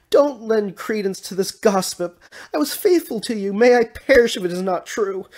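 high-pitched, loud protest of innocence; loud, desperate pleading; pleading shout. A narrator's reading. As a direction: shout it up as a frantic plea. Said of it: "the man in the bonnet shouted".

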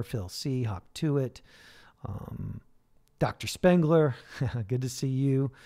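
normal talking voice

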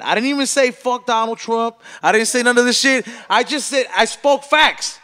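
fired up, dragging out the words; heated defence. Real, not acted. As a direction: hammer each line home, loud. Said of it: "defending himself, heated".

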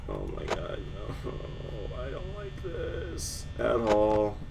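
hushed, drawn-out dread; frightened soft whine; scared whimper. Real, not acted. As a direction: whimper it under your breath, slowly. Scared player during horror game.

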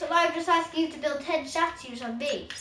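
ordinary talk

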